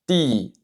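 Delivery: ti dit net, quatrième ton; Mandarin ti. Shown as a demonstration. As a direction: ti descendant, entre d et t. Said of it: "qui se prononce ti". (ti, ton descendant)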